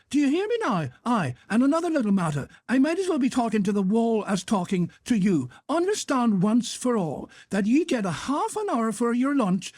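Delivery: put-on high-pitched, irate boss with North of Ireland accent; indignant, high-pitched telling-off; angry scolding. A narrator's reading. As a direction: berate a slacking employee. Boss berates his clerk.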